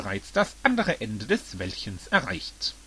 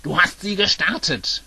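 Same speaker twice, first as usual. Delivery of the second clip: loud, higher-pitched outburst; shouting; 5 semitones higher, 5 dB louder than usual. A narrator's reading. Bowser yells at Max over the started machine, shouting. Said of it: "schrie Bowser".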